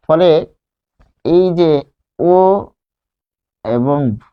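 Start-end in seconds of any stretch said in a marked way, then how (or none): none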